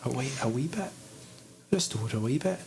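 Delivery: put-on a light Scottish accent. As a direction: coax it gently in a Scottish accent. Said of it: "Scottish accent".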